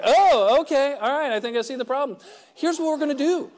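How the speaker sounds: high, loud "oh" of discovery; sudden eager realisation. Retold or imitated. Stranger spotting the newcomer's problem, eager realisation. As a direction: imitate an eager know-it-all taking charge.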